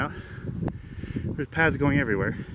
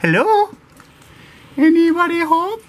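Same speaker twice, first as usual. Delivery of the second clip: calling out; 6 dB louder than usual. Pretend. Calling into a cave.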